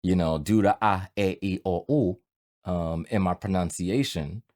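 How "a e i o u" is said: "A, E, I, O, U" as pure Spanish vowels, ah-eh-ee-oh-oo; Spanish vowel drill on "A, E, I, O, U"; Spanish vowel sounds. Shown as a demonstration. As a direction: pronounce "A, E, I, O, U" the Spanish way. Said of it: "when I pronounce things".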